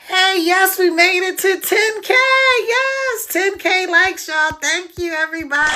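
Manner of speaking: real, high-pitched cheering thanks; excited celebration. Real, not acted. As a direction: celebrate a milestone with your followers, voice jumping up. Celebrates reaching ten K likes.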